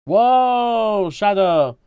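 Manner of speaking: loud, high, drawn-out whoa; startled shout. Real, not acted. Streamer reacting to Shadow in the game, startled shout.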